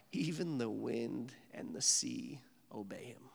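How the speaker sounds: slow, reverent whisper; hushed awe. Real, not acted. Preacher marvels at God's power over the storm, whispering. Awed close of the storm story.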